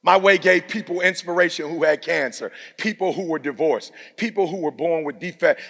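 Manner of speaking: ordinary talk, nothing marked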